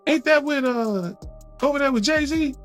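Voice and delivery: high, disbelieving question; incredulous question. Retold or imitated. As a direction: imitate a disbelieving friend. Pimp C quoted high voice.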